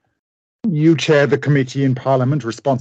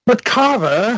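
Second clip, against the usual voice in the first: much higher, pleading cry; desperate protest; 10 semitones higher, 4 dB louder, slower than usual. Acted. Jekyll protesting as his old friend refuses. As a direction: cry out in disbelief.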